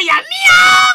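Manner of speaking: very high, loud mock meow; mimicked meow. Reactor imitates the rapper's crude lyric, loud high-pitched mock meow. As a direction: squeal it loud, stretching the meow. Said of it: "He said".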